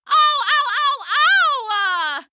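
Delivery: high, loud string of ow, ow; pained yelps. Acted. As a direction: hop and yelp in pain. Pirate's foot crushed, hopping.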